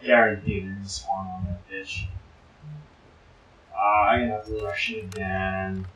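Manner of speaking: usual speaking voice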